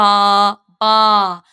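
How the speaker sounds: "Wa-sta-ba" said slowly, syllable by syllable; syllable-split pronunciation. Acted. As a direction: pronounce "Wa-sta-ba" in three separate beats. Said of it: "That's pronounced".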